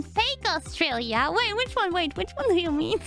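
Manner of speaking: usual speaking voice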